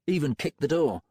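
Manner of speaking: ordinary delivery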